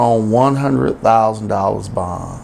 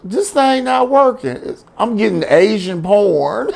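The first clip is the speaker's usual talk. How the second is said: put-on confused boyfriend; 7 semitones higher than usual. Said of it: "talking about".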